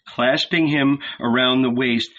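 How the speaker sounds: plain reading voice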